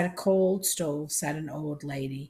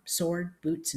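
plain reading voice

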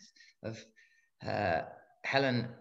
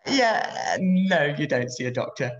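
laughing; 2 semitones higher, 7 dB louder than usual. Real, not acted. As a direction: answer through a real laugh. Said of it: "I know I'm laughing".

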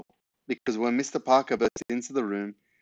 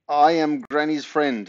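loud, slow, higher-pitched blurting; panicked splutter; 5 semitones higher, 6 dB louder, slower than usual. A narrator's reading. Boy excuses himself to the neighbour, panicked splutter. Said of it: "spluttered Ben".

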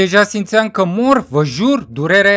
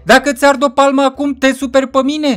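high, loud character voice; challenging friend; 7 semitones higher, 4 dB louder than usual. Acted. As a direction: bait him, pitched well up.

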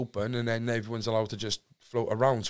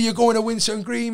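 mocking taunt; 11 semitones higher, 8 dB louder than usual. Inmates teasing about transfer.

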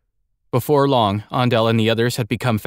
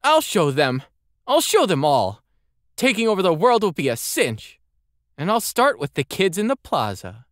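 put-on scheming boy; 10 semitones higher than usual. Andel, scheming.

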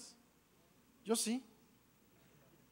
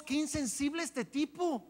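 scornful crowd; 8 semitones higher, slower than usual. Retold or imitated. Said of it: "Imagínense todos".

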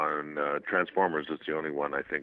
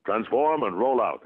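higher, ringing command; performed robot-leader catchphrase; Optimus toy voice; 4 semitones higher, 3 dB louder than usual. Acted. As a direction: echo the toy's battle order. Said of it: "And I go".

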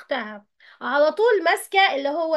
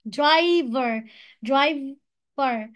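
"driver" broken as dri... ver; split into syllables; much slower than usual. Shown as a demonstration. Teacher shows two syllables, "driver" split in two. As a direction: say "driver" in two separate beats. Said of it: "دي برضو two syllables".

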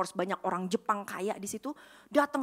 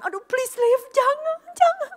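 high, loud, tearful pleading; mother crying; 14 semitones higher, 8 dB louder than usual. Retold or imitated. Mom begs her daughter not to be a hostess, tearful high wail. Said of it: "Dan my mom nangis".

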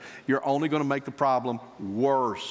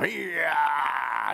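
put-on playful baby cheer.

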